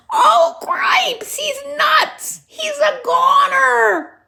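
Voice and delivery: high squeaky panicked shouting, slowing at the end; a frantic little mouse yelling. Acted. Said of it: "shouted Furlow again".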